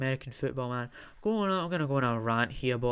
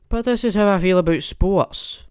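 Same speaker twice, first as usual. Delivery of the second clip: much louder, higher burst; loud declaration; 6 semitones higher, 13 dB louder than usual. Real, not acted. Vlogger admitting to viewers, loud and high. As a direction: belt it out as a confession.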